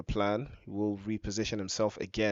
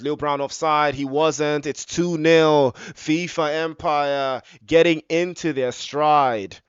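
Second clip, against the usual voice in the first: rising, louder goal call; excited goal call; 4 semitones higher, 7 dB louder, slower than usual. Real, not acted. Goal called in commentary.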